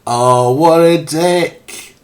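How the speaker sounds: loud and slow, stretched out; drawn-out loud exclamation. Real, not acted. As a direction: draw it out loudly in disbelief. Repeats it, drawn out.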